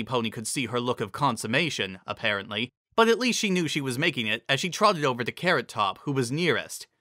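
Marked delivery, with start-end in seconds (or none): none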